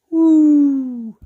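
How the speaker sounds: long, loud, high "woo". Real, not acted. Revealing to viewers what the project sword becomes, high woo. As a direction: let out a sustained high-pitched woo.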